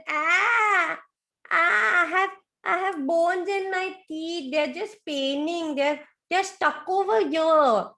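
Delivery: put-on high, whining child voice; high whimpering baby croc; whiny baby crocodile. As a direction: complain like a small hurting child. Baby crocodile complaining, teeth hurt.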